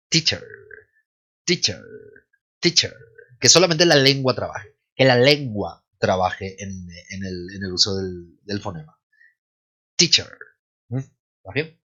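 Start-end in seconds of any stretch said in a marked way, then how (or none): 0.1-2.9 "teacher" with only the tongue shaping a faint last vowel; tongue-led weak ending. Shown as a demonstration. Teacher drilling the word, correct weak ending. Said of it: "la E muda". 10.0-10.4 "teacher" ending between A and U, very light; light schwa. Shown as a demonstration. Teacher demonstrating the vowel, light schwa ending. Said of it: "Ese sonido está entre una A y una U".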